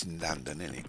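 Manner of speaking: tossed-off Cockney tag question; a casual Cockney "innit" to close; light mock Cockney aside. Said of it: "Maybe I should talk like Cockney".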